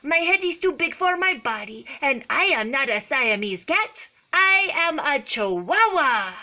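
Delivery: put-on high, loud play Spanish accent. A narrator's reading. Kitten claims to be a Chihuahua, proud Spanish accent. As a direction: declare it with a hammed-up Spanish accent. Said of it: "using his very best Spanish accent".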